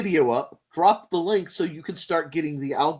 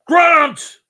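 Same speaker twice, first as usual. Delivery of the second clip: loud high call; 12 semitones higher, 11 dB louder than usual. Real, not acted. Calling Grant, loud. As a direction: shout his name across the room.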